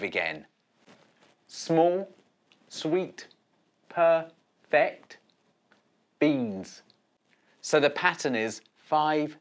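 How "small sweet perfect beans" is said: slow, each word of small, sweet, perfect beans set apart; counting syllables. Shown as a demonstration. Teacher counting five syllables for children, slowly. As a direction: pause after every word as you count. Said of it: "the number of syllables in the third line".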